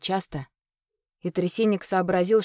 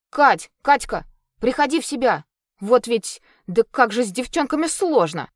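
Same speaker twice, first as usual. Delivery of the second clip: high, loud urgent calling; 5 semitones higher, 4 dB louder than usual. Acted. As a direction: call her back to her senses. Степан пытается привести Катю в чувство. (громкий встревоженный оклик)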